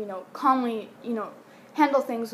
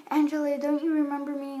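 high, louder mock pleading; insistent drunk stranger; 6 semitones higher, 5 dB louder than usual. Drunk stranger mistaking her for Angela, imitated insistent plea. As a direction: imitate someone desperate to be recognised.

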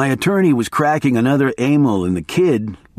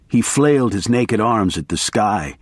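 ordinary delivery

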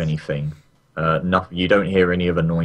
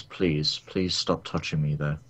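soft, low, understated request; quiet plea; 5 semitones lower, 8 dB quieter, slower than usual. Acted. Game master voicing a character asking a player to stop, quietly pleading. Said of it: "just looks at you and says".

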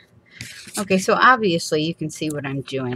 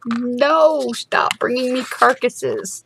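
higher, slow exasperated scolding; mock scolding; 9 semitones higher, slower than usual. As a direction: scold the dog with a sigh. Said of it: "answering the dog".

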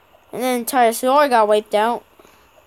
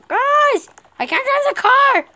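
panicked; 11 semitones higher, 4 dB louder than usual. Real, not acted. Panicking in game car.

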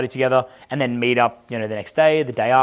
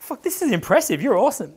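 much higher, gushing with awe; impressed gushing; 10 semitones higher than usual. Retold or imitated. Praising Sarah's pickup tips, he gushes up high in awe. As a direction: rave like a starstruck fan.

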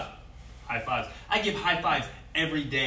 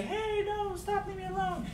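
high, soft, meek little voice; a hint of a frightened kid pleading quietly; timid weak protest; 7 dB quieter than usual. Shown as a demonstration. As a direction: say it like you're too scared to speak up. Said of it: "You can't just whisper it".

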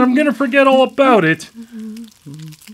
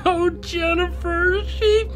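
high, breaking into a cry; the worst dawning on him; horrified realization; 9 semitones higher, slower than usual. Acted. Brother realizing his girlfriend was killed, horrified crying.

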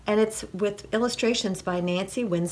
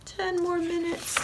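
high, pleading farmer voice; sleepy farmer; 9 semitones higher, 4 dB quieter than usual. A narrator's reading. Farmer wanting to sleep on, high and pleading. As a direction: plead softly for more time in bed. Farmer's line repeated in his voice.

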